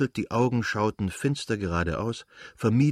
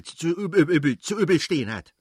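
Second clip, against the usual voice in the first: tongue-tied stumble; 8 semitones higher, 5 dB louder than usual. A narrator's reading. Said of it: "dass er sich verhaspelte".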